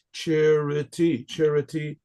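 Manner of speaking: "Charity," stretched slowly, the stress on "Cha"; drawn-out word. Shown as a demonstration. Teacher leads the class, stretching the word slowly. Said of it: "teacher drills the word".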